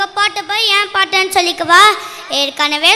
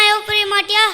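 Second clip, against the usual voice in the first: usual speaking voice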